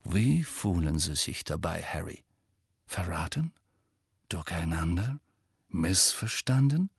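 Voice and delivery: hoarse whisper. A narrator's reading. Rita probing Harry's feelings in a hoarse whisper. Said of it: "fügte heiser flüsternd hinzu".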